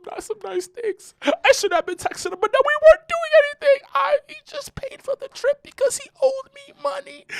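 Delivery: high, whining mock girlfriend. Imitating girlfriend's reverse psychology, high and teary. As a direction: whimper it high and fast. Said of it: "She's like".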